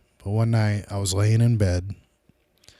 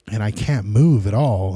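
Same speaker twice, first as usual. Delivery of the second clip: usual speaking voice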